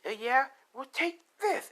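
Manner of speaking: high, loud acted defiance; defiant attack. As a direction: hurl it up high as a fighting wife. Wife attacks in retold fight.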